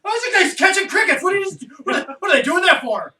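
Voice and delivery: loud bewildered outcry at the film; baffled shout. Real, not acted. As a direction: yell at the screen in disbelief. Baffled outburst at the screen.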